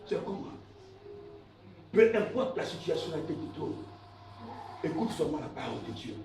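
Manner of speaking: low, very soft sermon aside; hushed preaching. Real, not acted. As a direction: drop right down, as if confiding in them. Preacher drops to quiet aside. (prédication à voix basse)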